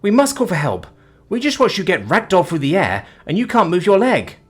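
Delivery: put-on a frightened young woman insisting on help; alarmed. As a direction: Sophia urging they get help. Sophia alarmed.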